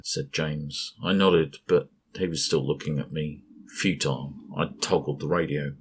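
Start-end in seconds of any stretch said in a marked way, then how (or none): none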